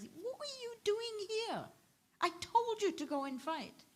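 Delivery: a mother's stern rebuke, voiced high; scolding mother. Mother scolds her son, retold.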